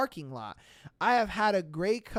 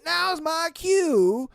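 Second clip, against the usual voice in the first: mock tough trucker; 8 semitones higher, 6 dB louder than usual. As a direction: ham up the trucker's threat. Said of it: "and says".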